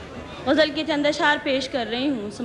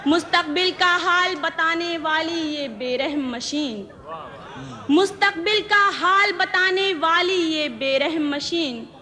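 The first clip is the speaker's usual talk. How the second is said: measured verse, repeats lifted higher; ghazal recitation; 4 semitones higher than usual. Acted. Poet presenting her ghazal to the gathering, measured recitation. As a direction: recite each line deliberately, pausing between phrases.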